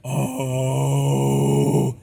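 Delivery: rattling voice cracking into air; vibrating false-chord distortion. Shown as a demonstration. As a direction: sound like talking through a fan. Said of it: "really let it vibrate".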